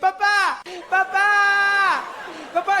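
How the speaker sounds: high mock child cries; terrified little boy. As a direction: cry out high like a frightened kid, hammed up.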